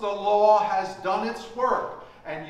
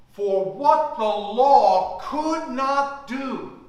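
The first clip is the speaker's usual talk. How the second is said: emphatic scripture reading; 3 dB louder than usual. Acted. Reads scripture aloud to congregation.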